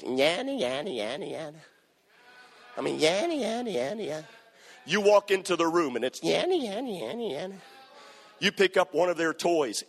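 mock a whiny little brother; whining sibling. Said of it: "they're always".

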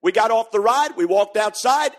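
ordinary talk

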